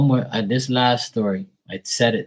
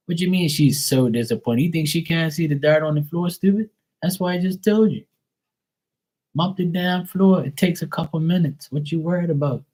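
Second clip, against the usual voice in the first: berating rant; 7 semitones higher than usual. Retold or imitated. Medium berating client again.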